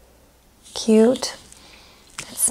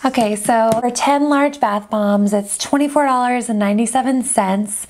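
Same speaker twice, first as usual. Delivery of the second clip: ordinary talk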